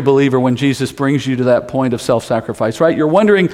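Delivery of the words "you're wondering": ordinary talk, nothing marked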